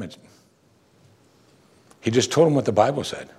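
ordinary delivery

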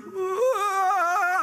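high, drawn-out mock crying howl; imitated child's wail. Startled little kid after being yelled at, mock wail. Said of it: "he started melting and trembling".

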